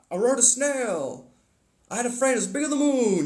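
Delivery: high silly voice rambling nonsense; silly voice impression. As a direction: play the dopey comedy character. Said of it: "the guy that does the voices".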